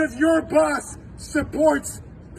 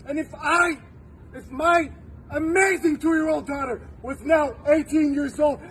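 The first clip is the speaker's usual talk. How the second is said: ordinary delivery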